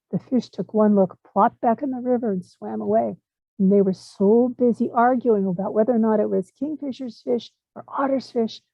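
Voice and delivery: ordinary delivery, nothing marked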